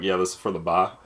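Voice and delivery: "ba" as bar with no R; dropped R. Retold or imitated. Server bringing over an order, "ba" with R dropped. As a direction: say bar as "ba", Boston style. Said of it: "I almost never said bar".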